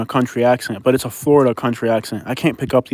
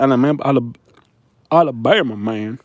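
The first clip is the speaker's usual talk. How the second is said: put-on Alabama accent. Mocking Alabama man after accent talk.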